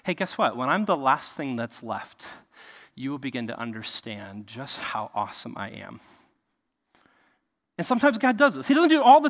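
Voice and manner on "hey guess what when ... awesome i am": lightly voiced God speaking softly, confiding; God's voice, quietly. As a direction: lower and softer, a hushed divine promise. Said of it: "that Yahweh says".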